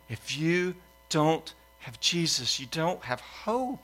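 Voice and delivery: quiet, slow warning to the congregation; solemn sermon point. Real, not acted. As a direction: let the hard truth land slowly.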